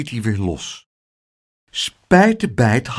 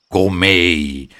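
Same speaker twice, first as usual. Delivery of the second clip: growling bear; 5 semitones lower than usual. A narrator's reading. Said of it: "gromde Moem".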